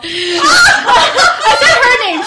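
loud, high laughing squeal; drawn-out squeal through laughter; laughing dismay. Real, not acted. Speaker realises she let her friend's name slip, laughing dismay.

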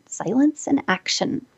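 slow hushed restatement; quiet emphasis. Shown as a demonstration. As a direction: drop the voice to drive the point home.

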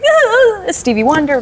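high, swooning mock awe; exaggerated fan thrill, starting very high; starstruck. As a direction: act out gushing at a legend.

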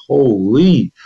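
high, sharp gasp of disbelief; a startled half-curse, cut off; stunned exclamation. Retold or imitated. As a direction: blurt it out in shock. Reacting to the intense shock.

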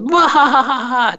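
high hammed-up villain cackle; evil laugh. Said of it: "This is my evil laugh".

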